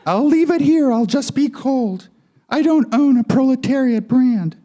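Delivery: high feminine twang; high-voiced puppet-show doll with a twang; Barbie's feminine twang. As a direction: pitch it up into a girly doll voice. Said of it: "In her feminine twang".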